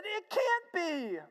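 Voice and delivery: high, slow, overcome female voice; imitated tearful disbelief. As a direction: play her too moved to believe it. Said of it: "she starts to cry".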